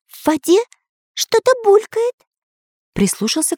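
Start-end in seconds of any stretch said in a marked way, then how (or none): 0.1-2.1 much higher cartoon voice; curious little hero listening; Pencil character voice. A narrator's reading. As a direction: Pencil hearing bubbling in the water.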